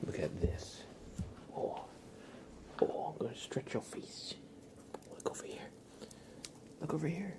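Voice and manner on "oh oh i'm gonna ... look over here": ordinary delivery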